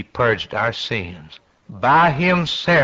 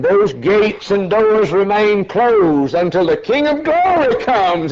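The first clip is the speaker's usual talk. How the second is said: climbing higher toward the King; rising preaching; 8 semitones higher, 3 dB louder than usual. Real, not acted. Preacher building to the King's coming, rising and louder.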